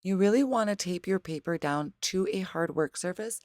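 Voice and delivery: ordinary talk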